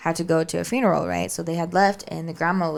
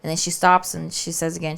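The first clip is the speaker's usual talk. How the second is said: ordinary talk, nothing marked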